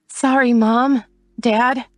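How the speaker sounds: high, teary apology; tearful. A narrator's reading. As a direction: say it close to crying. Casey crying as she leaves.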